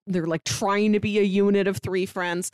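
normal talking voice

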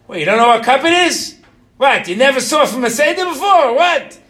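loud, high, incredulous Brooklyn outburst; mock-outraged shout in a Brooklyn accent. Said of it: "why I go Brooklyn when I have that".

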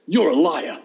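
imitated furious schoolgirl, punched loud; angry little girl. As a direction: burst out like an indignant child. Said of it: "look really angry".

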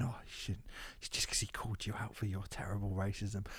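very soft, low mock scolding; barely voiced, put-on reproach; hushed mock disapproval. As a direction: imitate tutting critics under your breath. Mimics disapproving people online.

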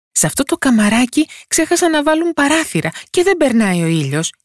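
high-pitched tiny complaining voice; poor tiny character's lament; hapless little fellow. A narrator's reading. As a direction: grumble in a small, high voice. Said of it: "είπε ο δύστιχος Τοσοδούλης".

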